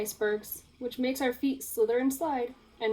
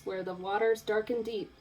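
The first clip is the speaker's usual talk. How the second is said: ordinary delivery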